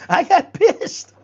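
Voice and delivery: high breathy laugh into the words; amused laugh about getting angry. Real, not acted. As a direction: laugh at yourself, slow and squeaky. Laughing at own anger.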